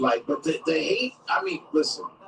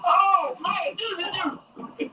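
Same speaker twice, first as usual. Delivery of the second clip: high mocking jeers broken by laughter; jeering inmates; 12 semitones higher, much slower than usual. Inmates mocking Smollett's name, high laughing jeers. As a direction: tease him like a crowd of cellmates. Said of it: "They gonna be like".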